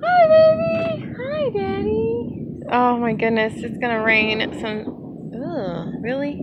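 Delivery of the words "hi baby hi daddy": very high, slow sing-song coo. Real, not acted. Mother cooing at son.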